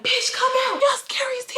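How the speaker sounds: very high, thin mock scream; retold screaming. Girl at the window calling them out, mock screaming. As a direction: imitate yelling up at a window without full volume. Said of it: "I'm screaming at the window".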